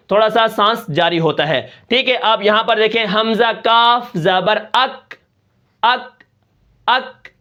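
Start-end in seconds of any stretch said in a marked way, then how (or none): 3.1-7.3 teacher showing the breath release on اک; slow spelling demo. Shown as a demonstration. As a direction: spell اک slowly with a small puff of breath after the ک. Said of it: "یہ تھوڑی سی جو چھوٹی آواز نکل رہی ہے".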